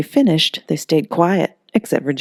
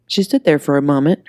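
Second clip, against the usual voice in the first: plain reading voice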